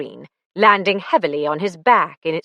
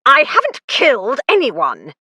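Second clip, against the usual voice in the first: raised, louder urgent denial; 6 semitones higher, 4 dB louder than usual. A narrator's reading. Rupert urgently denying murder to the police. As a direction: insist on it, pressing hard. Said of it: "Rupert repeated urgently".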